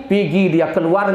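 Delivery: ordinary delivery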